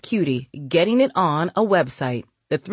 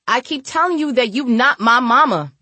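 high, louder sassy backtalk; snotty girl; 7 semitones higher, 4 dB louder than usual. A narrator's reading. Girl tells older woman off, snotty backtalk. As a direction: talk back in a snotty, raised voice. Cutie talks back to Mooney.

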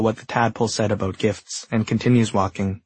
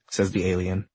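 usual speaking voice

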